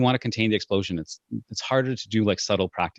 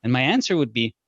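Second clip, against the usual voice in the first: ordinary delivery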